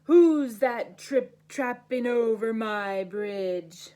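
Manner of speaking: slow, loud troll challenge; troll shouting. Acted. Troll shouts at the goat crossing his bridge, slow and loud. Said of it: "the smelly troll shouted".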